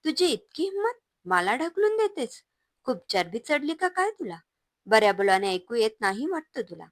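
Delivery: high, loud enraged husband; furious outburst. A narrator's reading. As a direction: spit it out shaking with rage. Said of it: "प्रचंड संतापाने थरथरत तो तनतनत". (संतापलेला, थरथरता आवाज)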